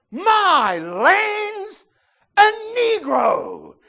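high, loud, slow amazed shout; thrilled shout. Acted. Movie man meets a stranger, high thrilled slow shout. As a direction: drag it out in delight. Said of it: "and says".

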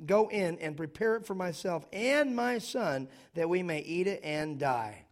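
loud, emphatic scripture preaching. Acted. Pastor preaching to the congregation, loud and emphatic. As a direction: proclaim the text with full force.

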